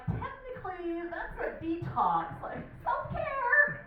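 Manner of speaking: high-pitched, pleased little inner voice; singsong high voice of self-justification; chiming-in inner voice. Acted. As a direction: chime in high, stretching the last word. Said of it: "This other voice chimes in".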